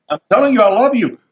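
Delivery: high-pitched exasperated insisting; retold insistence. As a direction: re-enact pleading your case to dad. Son insisting to baffled father.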